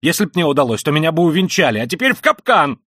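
high, loud, impassioned ranting; frenzied tirade. Acted. Raskolnikov ranting at his sister, frenzied and loud. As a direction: hurl it all out in frenzy.

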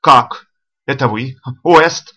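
loud burst of surprise; loud, startled outcry; startled exclamation. A narrator's reading. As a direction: exclaim in angry astonishment. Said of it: "воскликнул он".

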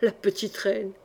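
plain reading voice

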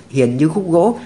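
plain reading voice

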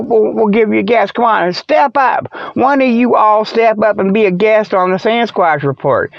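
normal talking voice

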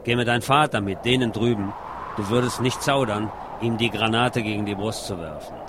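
usual speaking voice

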